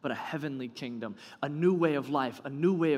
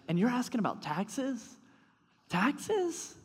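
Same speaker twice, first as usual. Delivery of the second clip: incredulous; 10 semitones higher, slower than usual. Retold or imitated. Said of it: "almost frustrated".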